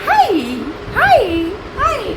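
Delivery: very high, cutesy imitation of a toddler; sweet toddler hi. Mother copying how lovingly her child says hi, sweet baby voice. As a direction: mimic a little one's adoring "hi". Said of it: "इतने प्यार से बोलता है".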